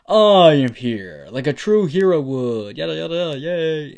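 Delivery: big, booming hero announcement; hammed-up superhero proclamation, very loud; heroic entrance. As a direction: belt it out, drawn-out and triumphant. All Might's grand hero entrance.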